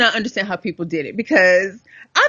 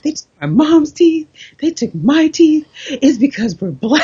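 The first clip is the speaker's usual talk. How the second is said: drugged tech rant; 7 semitones higher, 3 dB louder than usual. Retold or imitated. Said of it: "He's like".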